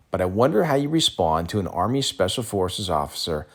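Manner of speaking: usual speaking voice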